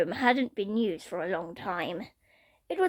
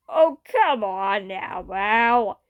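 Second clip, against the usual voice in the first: slow lazy protest; drawn-out whine; 4 dB louder, much slower than usual. Acted. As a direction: stretch it out, unbothered. Badgerclops brushing off Mal.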